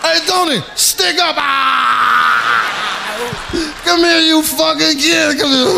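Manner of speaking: high-pitched mock-mobster holler, breaking into "ahh"; amused mobster. Mobster shows Tony the kid's stick-up, hammed-up amused holler. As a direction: whoop to your pals in hammed-up hilarity. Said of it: "he started laughing".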